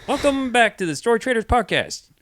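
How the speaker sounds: high, loud announcer intro. Shown as a demonstration. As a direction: open the show like an announcer. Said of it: "Take it away".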